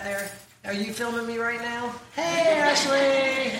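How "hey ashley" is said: calling out. Real, not acted. Calling to daughter at back.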